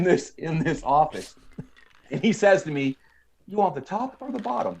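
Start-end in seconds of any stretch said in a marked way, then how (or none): 3.5-4.8 quick, high, soft mock Southern drawl. Clerk setting up the wrestling match, high mock Southern drawl. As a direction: ask it in a put-on, grating Southern drawl. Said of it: "that Southern drawl accent".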